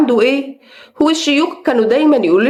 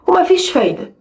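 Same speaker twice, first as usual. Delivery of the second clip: usual speaking voice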